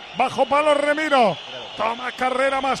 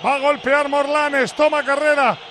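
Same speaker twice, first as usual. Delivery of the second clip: ordinary delivery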